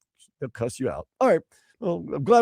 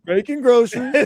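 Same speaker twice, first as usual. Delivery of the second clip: laughing echo; 8 semitones higher, 5 dB louder than usual. Real, not acted. Laughing echo of local phrase.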